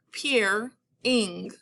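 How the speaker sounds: PEER-ING with a clear pause after "peer"; stop mid-word. Shown as a demonstration. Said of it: "say that once more".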